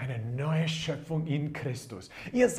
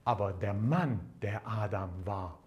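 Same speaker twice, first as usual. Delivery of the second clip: ordinary talk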